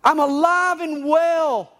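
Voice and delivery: high, sustained triumphant shout; exultant, drawn-out high cry; Jesus shouting triumphantly. A narrator's reading. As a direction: shout the good news to everyone. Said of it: "shouting every day to every living person".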